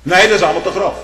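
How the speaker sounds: quoting those who find it too coarse; voicing the objectors. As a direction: imitate the critics' protest. Mocking objectors' reply.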